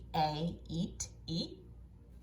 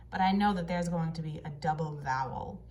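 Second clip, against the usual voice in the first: usual speaking voice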